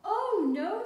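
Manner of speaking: high, loud exasperated cry. A narrator's reading. Mister Bear kept awake, loud exasperated cry. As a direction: wail it in frustration. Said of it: "said Mister Bear".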